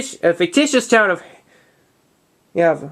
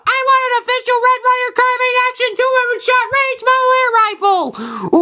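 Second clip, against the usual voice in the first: put-on high, breathless kid begging for a present; excited kid voice. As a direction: squeal it like a kid begging for his gift. Retelling kid Ralphie's wish.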